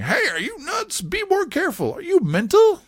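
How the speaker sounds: high, shrieking scold; mock scolding. Host voices the men scolding Mickey after the crash, high and angry. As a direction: yell it at the reckless boatman. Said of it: "scolding Mickey after the boat crash".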